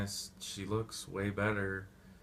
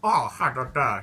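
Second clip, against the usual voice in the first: loud gleeful villain laugh; hammed-up evil laugh; mock villain cackle; 3 semitones higher, 8 dB louder than usual. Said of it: "he's like".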